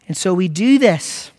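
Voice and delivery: usual speaking voice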